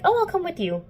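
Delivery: hammed-up keen boy volunteering; eager Jace. Jace voiced offering eagerly.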